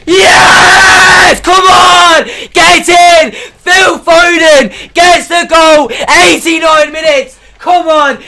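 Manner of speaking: a fan roaring as his team scores late; goal celebration. Real, not acted.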